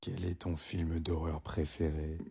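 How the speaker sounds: low, slow, menacing killer voice; killer's voice. Acted. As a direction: draw it out like a horror-film killer. Voix du tueur au téléphone. (voix de tueur, grave et lente)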